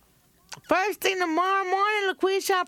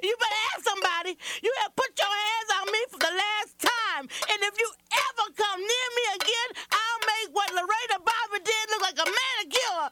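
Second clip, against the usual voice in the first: fierce defiance; 5 semitones higher than usual. Acted. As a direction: throw him out at last. Wife finally fights back.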